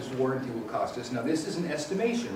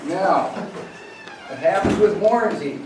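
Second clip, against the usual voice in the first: loud, barking Roman soldier; Centurion voice; 6 semitones higher, 8 dB louder than usual. Pretend. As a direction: bark it out like a drill sergeant. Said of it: "that was a good Centurion voice".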